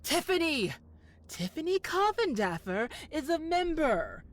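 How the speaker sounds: high, loud gleeful announcement; spring the secret on him like a trap; triumphant reveal. Acted. Dramatic reveal about Tiffany.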